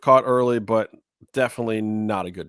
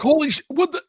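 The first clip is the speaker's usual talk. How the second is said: shocked fan reaction; 4 dB louder, faster than usual. Retold or imitated. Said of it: "And everyone's like".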